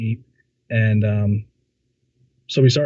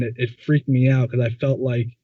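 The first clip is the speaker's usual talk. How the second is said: usual speaking voice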